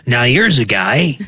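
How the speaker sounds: rough, gravelly sportscaster voice; Chris Collinsworth gravelly voice. Retold or imitated. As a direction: growl it like a football analyst. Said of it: "That's just me in a gravelly voice".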